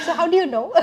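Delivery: high, loud question through laughter; amused mock surprise. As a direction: laugh while acting out the question. Amused at being placed by accent.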